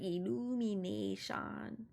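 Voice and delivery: slow, drawn-out Minion-style illumination; lightly voiced cartoon Minion, stretched out. Echoing the game's line in a mock Minion voice. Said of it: "what are those little yellow things called".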